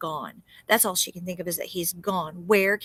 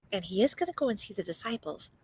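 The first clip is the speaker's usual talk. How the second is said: plain reading voice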